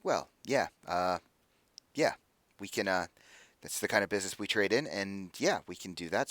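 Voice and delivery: low, soft negotiator voice; mercenary leader, hesitant. Acted. Mercenary leader agreeing to a client's business, hesitant and low. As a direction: talk terms quietly, pausing to think.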